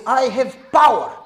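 lightly voiced domineering elder; boastful elder. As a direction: puff up like a bossy old elder. Head elder boasting to pastor.

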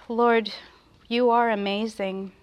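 ordinary delivery

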